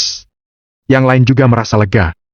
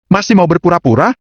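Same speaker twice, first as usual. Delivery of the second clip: high-pitched taunting question; Lawrence; 7 semitones higher than usual. A narrator's reading. Rival accuses Agam of still pretending, taunting. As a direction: challenge him in a raised, taunting pitch.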